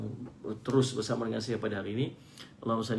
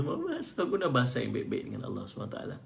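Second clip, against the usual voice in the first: normal talking voice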